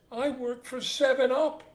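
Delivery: high, loud comic despair; acted dismay. Last father dreads how many babies are coming, comic dismay. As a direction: wail the punchline in mock distress. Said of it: "starts groaning and banging against the wall".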